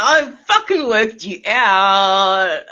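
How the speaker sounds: slurring tipsy triumph. Real, not acted. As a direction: savor the gotcha, tipsy. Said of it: "Sorry, I'm drunk".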